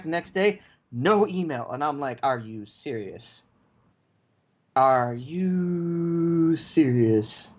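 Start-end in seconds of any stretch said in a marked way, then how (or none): 2.2-3.4 low, quiet and slow, deflated; quiet disbelieving mutter; muttered disbelief. Retold or imitated. In disbelief at no email. 4.7-7.5 long exasperated drawl; drawn-out disbelief. Retold or imitated. Repeats it stretched out.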